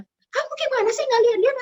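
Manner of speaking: high, sharp mock scolding; a hammed-up scold about a broken plate. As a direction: snap at them like an annoyed parent.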